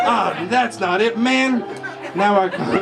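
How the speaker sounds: usual speaking voice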